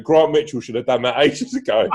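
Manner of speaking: a hint of a hard man's jibe, laughing through it; lightly voiced local tough, smug after the punch; imitating a geezer. Retelling the Croydon story, mocking the geezer's jibe, laughing. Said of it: "he went".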